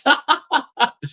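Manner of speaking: high-pitched giggle after the impression; high laugh. Real, not acted. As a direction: burst into a squeaky laugh.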